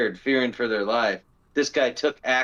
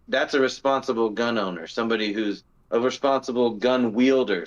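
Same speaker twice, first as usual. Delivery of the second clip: ordinary talk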